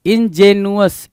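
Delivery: इंजेनुअस slowly as "in-je-nu-us"; misspelling read out. Shown as a demonstration. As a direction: sound out इंजेनुअस with "nu-us" ending. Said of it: "इंजेनुअस कुछ नहीं होता है".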